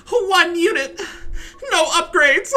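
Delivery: high, loud, slow wailing whine; mocking crying opponent. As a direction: wail it like a crybaby complaining. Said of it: "uh, Bishop".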